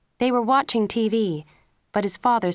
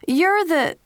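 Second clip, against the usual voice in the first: angry outburst; 11 semitones higher, 7 dB louder than usual. A narrator's reading. Angry father cut off.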